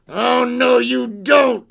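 loud, high, defiant refusal; creature's cry. Acted. Creature refuses crying girl, loud high cry. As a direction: shout it down mischievously. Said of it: "cried the fly-by-night".

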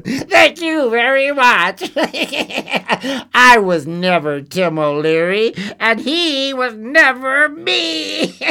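high, slow, mocking goblin voice through laughter; laughing goblin. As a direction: gloat and laugh as you reveal the trick. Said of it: "cried the goblin".

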